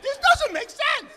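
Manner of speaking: high, slow, laughing disbelief; loud amazed protest at the checkout; incredulous shout. Retold or imitated. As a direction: blurt it out in comic disbelief. Incredulous at unprotected bag boy.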